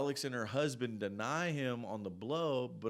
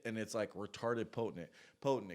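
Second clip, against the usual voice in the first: usual speaking voice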